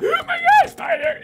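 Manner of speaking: very high gasped shriek; breath-catching high squeal; real spider fright. Real, not acted. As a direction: yelp in horror at a spider. Screams at seeing spider.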